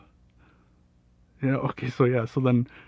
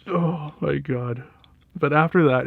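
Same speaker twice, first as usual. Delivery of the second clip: normal talking voice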